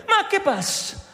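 high mock Italian answer; quick startled Italian reply; Italian accent. Cornelio answering the angel, Italian accent. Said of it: "a lo italiano".

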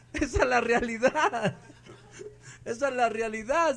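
laughing. Real, not acted.

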